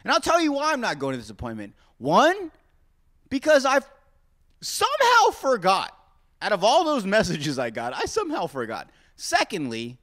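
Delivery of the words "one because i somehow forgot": mock dramatic. Real, not acted. Mock dramatic listing of reasons.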